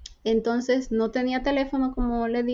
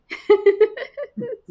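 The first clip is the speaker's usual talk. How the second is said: laugh; 8 semitones higher, 5 dB louder than usual. Real, not acted. Punchline after saying she prayed.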